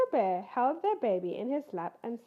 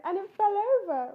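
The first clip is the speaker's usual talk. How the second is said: higher, louder wailing cub; higher, louder cub's wail; 9 semitones higher, 7 dB louder than usual. Acted. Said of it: "wailed Fuzz".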